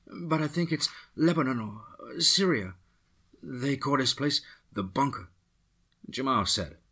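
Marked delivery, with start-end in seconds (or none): 0.0-5.3 put-on captive woman answers the soldier in a Latin accent; unsure woman. A narrator's reading. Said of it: "she said".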